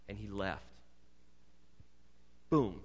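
normal talking voice

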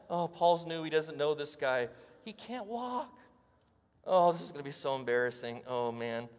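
hushed, wary mutter of a doubting bystander; doubting onlooker. Retold or imitated. A bystander whispering doubts to a neighbour, lightly voiced and hushed.

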